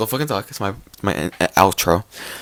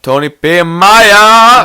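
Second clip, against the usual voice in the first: slow, high, loud call; 8 semitones higher, 7 dB louder, much slower than usual. Real, not acted. Shouting out listeners to the audience, slow and loud. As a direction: announce the names like a host.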